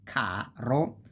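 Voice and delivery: caro slowly, ca drawn long; stress on second-to-last. Shown as a demonstration. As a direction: stretch out CA, then a short ro. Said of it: "falls on the second to last syllable".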